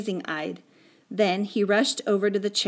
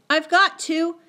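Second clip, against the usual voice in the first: high, louder desperate plea; panted insistence; 9 semitones higher, 6 dB louder than usual. A narrator's reading. Patrick wrenching free of his friend, panted insistence. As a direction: insist urgently, as if in a struggle. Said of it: "he panted".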